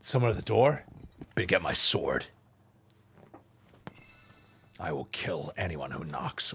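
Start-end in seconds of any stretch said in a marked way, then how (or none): 1.4-6.5 hammed-up low murderous voice; mock menace. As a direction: growl it low like a paranoid swordsman. Joking menace at the door.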